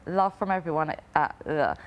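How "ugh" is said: normal talking voice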